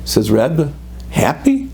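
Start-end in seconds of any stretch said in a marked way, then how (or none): 1.1-1.7 incredulous. Retold or imitated. Man incredulous at question.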